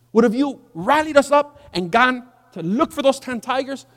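high-pitched, emphatic appeal; persuading servants. Acted. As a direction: reason urgently with an upset master. Servants pleading with angry commander.

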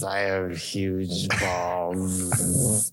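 put-on slow slurred drunk voice. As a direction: slur it like a drunk. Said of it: "I put in this drunk voice".

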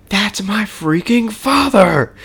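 mock indignant yell; exasperated outburst. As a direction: blurt it out, fed up and incredulous. Star-Lord's outburst, quoted.

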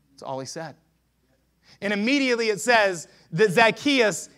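normal talking voice

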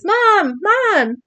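high, mildly hammed-up cry for mom; imitated crying. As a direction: call out as a crying daughter, pitched high. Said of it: "starts crying and says".

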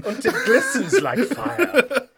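high loud laughing flourish. Pretend. Mock grandiose reading, laughing.

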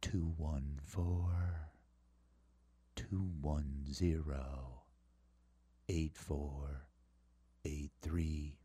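very low, hushed and drawn-out; slow put-on voice. Shown as a demonstration. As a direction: drop deep and soft, long pauses between groups. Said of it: "They don't ask me to do this voice".

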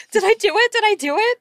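high, loud and gleeful; can't contain the thrill; excited squeal. Real, not acted. Player asking the GM if her plan worked, high and loud.

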